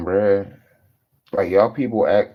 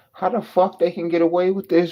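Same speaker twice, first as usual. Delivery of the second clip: normal talking voice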